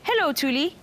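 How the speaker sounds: ordinary delivery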